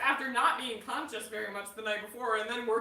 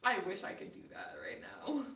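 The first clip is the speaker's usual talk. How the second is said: soft wistful whisper; 6 semitones lower, 10 dB quieter than usual. Real, not acted. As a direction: breathe it out quietly. Whispered reply.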